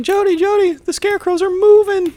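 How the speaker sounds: mock panicked falsetto; Mark. Boy warning his sister about moving scarecrows, mock panic. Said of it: "Mark's like".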